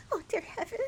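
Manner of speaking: high, hammed-up melodramatic sigh; nineteenth century acting. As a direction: overact like an old stage heroine. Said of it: "Nineteenth century acting".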